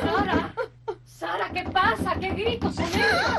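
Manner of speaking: high, anxious calling out; alarmed calling. Acted. Woman hears screams and calls Sara, alarmed. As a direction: call urgently over the screaming.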